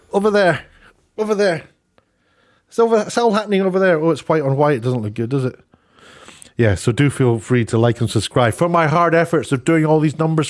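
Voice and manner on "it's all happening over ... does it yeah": normal talking voice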